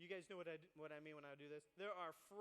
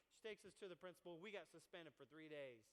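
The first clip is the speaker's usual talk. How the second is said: usual speaking voice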